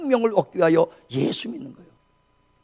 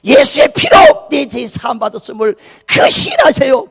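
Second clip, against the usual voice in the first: loud, high, slow exhortation; urgent shouted plea; 4 semitones higher, 9 dB louder, slower than usual. Retold or imitated. Son at dying father's ear, urgent shouted plea. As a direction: call out forcefully with drawn-out words. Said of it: "간절히 소리치며 기도합니다".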